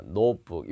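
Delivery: "notebook" as two syllables "no-book", t unreleased; 영어식 발음. Shown as a demonstration. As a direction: say "notebook" like "nope-book", no vowel after the t. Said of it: "이렇게 읽으세요". ("notebook"을 '노트북'이 아닌 '놋북'처럼 두 음절로)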